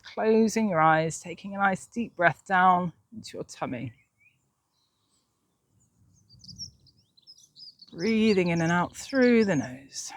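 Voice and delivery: slow, soft meditation voice; guided meditation. Acted. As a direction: ease them into calm breathing, slowly. Leads centering breathing meditation.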